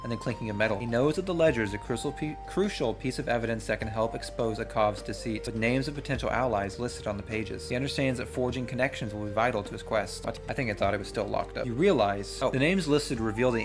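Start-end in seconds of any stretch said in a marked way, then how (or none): none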